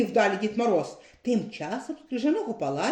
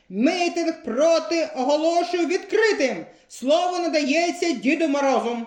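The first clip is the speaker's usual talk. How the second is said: вище, урочисто, як на зборах; відкриває мітинг з піднесенням; 7 semitones higher, 4 dB louder than usual. A narrator's reading. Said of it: "урочисто виголосила баба Морозиха". (баба Морозиха урочисто оголошує, вище)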